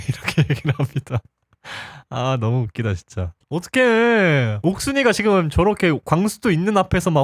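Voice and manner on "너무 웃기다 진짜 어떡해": ordinary talk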